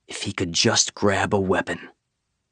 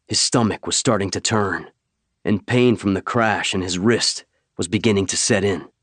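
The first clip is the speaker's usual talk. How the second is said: ordinary delivery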